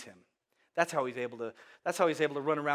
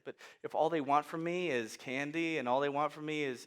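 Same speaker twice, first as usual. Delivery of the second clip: ordinary talk, nothing marked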